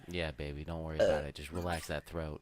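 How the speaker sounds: low, soft coaxing voice; soothing. Acted. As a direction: coax gently, like a lover. Mock seductive coaching.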